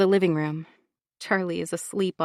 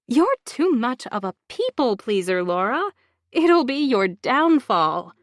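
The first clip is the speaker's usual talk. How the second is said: slow, higher, condescending gloat; 5 semitones higher, 3 dB louder, slower than usual. Acted. Bronwyn gloating over drugging Laura, slow and superior. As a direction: savour it like a villain.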